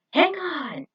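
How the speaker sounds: high loud call to hold on; urgent shout. Acted. J B shouting to the team clinging on, urgent. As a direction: tell them to grab tight.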